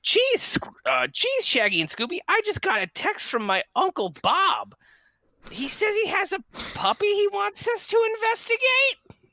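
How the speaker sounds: very high-pitched cartoon sleuth; Velma voice. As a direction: pipe up in a high cartoon character voice. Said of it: "go back into my Velma voice".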